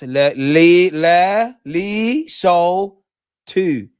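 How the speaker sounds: Lee, So, Two as separate, stretched syllables, loud; loud, drawn-out "Lee... So... Two", each on its own; sounding it out. Shown as a demonstration. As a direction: puzzle out "Le-, Lee, So, Two" piece by piece. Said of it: "you're saying it's pronounced".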